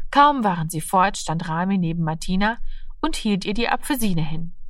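usual speaking voice

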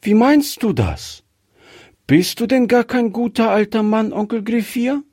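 put-on high, quick, astonished little girl's question; surprised child. A narrator's reading. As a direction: ask in disbelief, a child's surprise. Gretchen asking in surprise.

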